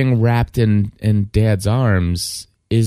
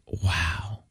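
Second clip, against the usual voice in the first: very soft, low wow; hushed wonder; 5 semitones lower, 12 dB quieter than usual. Retold or imitated. Dad moved by daughter's "I love you", hushed. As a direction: breathe it out low, quietly moved.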